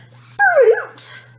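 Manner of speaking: very high, loud shriek; a girl's tantrum at its peak; shrieking fury. A narrator's reading. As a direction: scream it with full fury.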